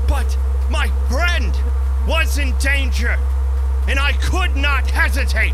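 breathless building alarm in the telling; rising panic. A narrator's reading. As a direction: read it like the dread is catching up with you. Narrator's panic rises as friend vanishes.

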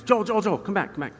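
high, urgent retold call; imitating dad calling out. Dad calls him back from field.

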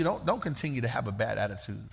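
soft, low, reassuring admonition; tender voice of the Lord. Acted. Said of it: "the Lord would say to you".

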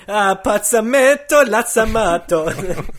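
high, loud fake Italian nonsense words, slowing down; mock Italian gibberish. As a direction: ham up a fake Italian accent with invented words. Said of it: "whenever you do a racist accent".

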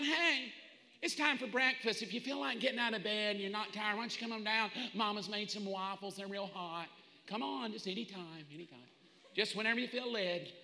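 soft, easygoing parent voice; gentle coaxing. Acted. Parent calling kids to breakfast, overly gentle coaxing. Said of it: "It's not".

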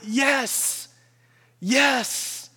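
YES pitched high and drawn out, each one standing alone; emphatic yes. Acted. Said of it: "the answer is emphatically".

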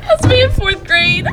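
high loud squeal, slowed down; embarrassed shriek. Real, not acted. Shrieking at childhood photo.

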